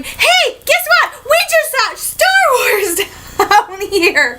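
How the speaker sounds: high-pitched giddy burst breaking into a laugh; excited blurting. Retold or imitated. Crew member bursting to tell morning commuters, giddy and excited. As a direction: blurt out a secret you're bursting to share. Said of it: "trying so hard not to blur out the fact".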